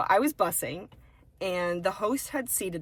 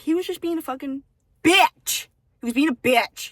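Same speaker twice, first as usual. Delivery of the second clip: retold anger; 7 semitones higher, 8 dB louder than usual. Telling manager about the customer.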